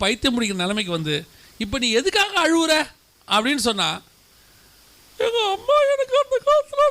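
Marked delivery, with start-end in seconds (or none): 1.7-2.7 exasperated husband. Retold or imitated. Husband asks crying wife why. 5.2-6.8 high, wailing tearful wife voice; high whiny wife. Retold or imitated. A weepy wife wails back at her husband, high and whiny.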